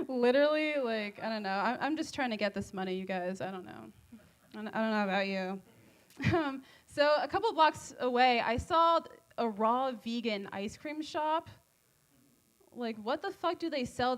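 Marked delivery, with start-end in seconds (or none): none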